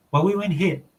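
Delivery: light Hawaiian Pidgin delivery; casual Hawaiian Pidgin line; Pidgin quote. Shown as a demonstration. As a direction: say it the local Pidgin way. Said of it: "instead of saying".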